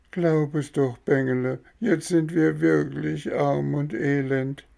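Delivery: high, whining plea, odd voice; pleading fox. Acted. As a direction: play the pitiful beggar. Fox begs Bengele.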